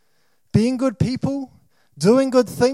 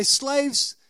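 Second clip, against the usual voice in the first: building from a light mock rally call to a louder, higher protest shout; hammed-up protest leader getting louder; mock rally cry; 4 semitones higher, 4 dB louder than usual. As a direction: rouse the slaves to storm Parliament, tongue in cheek. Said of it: "He doesn't say".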